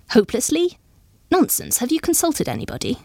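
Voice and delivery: high, rising echo, then brisk dismissal; incredulous dismissal. A narrator's reading. Guest waving away Lady Jane's chimney excuse, incredulous. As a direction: throw "Hopelessly?" back as a high question.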